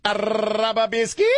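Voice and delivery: high, drawn-out rolled-R outburst; zany comic catchphrase. Pretend. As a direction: trill it manically, way up high. Clowning threat, nonsense comic catchphrase.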